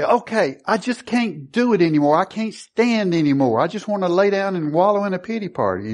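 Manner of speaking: high-pitched, acted complaint; mock self-pity. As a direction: play someone throwing a pity party. Voicing a believer giving up.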